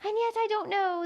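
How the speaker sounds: high, offhand little insect voice; careless gnat. A narrator's reading. Gnat musing carelessly to Alice, high and thin. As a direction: toss it off lightly, high and thin. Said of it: "the gnat went on in a careless tone".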